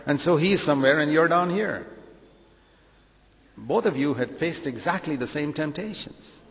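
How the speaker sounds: usual speaking voice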